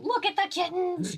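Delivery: high-pitched delighted cry; excited squeal. Real, not acted. Spotting kittens mid-chat, excited squeal. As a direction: squeal at something adorable.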